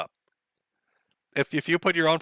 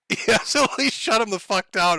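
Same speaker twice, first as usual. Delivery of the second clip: higher pitch, words breaking out of a laugh; laughing while talking; 6 semitones higher than usual. Real, not acted. Streamer delighted by how guards got shut down, laughing while talking. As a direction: say it through real amusement.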